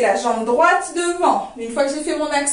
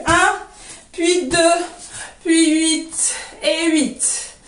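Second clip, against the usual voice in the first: vocalised dance rhythm; slower than usual. Shown as a demonstration. Dance teacher sounding out doum and rakatak for students, rhythmic cueing. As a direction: count in, then drum-like syllables on the beat.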